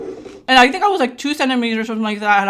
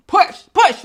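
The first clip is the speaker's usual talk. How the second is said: urging doctor; 8 semitones higher, 4 dB louder than usual. Retold or imitated. Acting doctor urging her to push.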